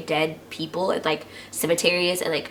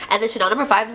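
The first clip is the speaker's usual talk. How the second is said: ordinary delivery, nothing marked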